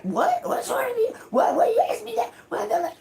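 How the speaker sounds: high, fast mock outrage with a laugh; indignant outburst. Grown child snapping at a parent's simple question, mock indignant and high. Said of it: "they fucking flip out and turn again".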